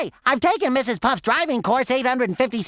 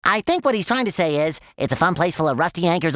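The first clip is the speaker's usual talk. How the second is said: ordinary delivery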